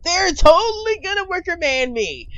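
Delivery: shrieked high and loud, hammed-up terror; mock panic. Gamer mock-panicking at the creepy wicker men, shrieking. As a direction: squeal it like a horror victim.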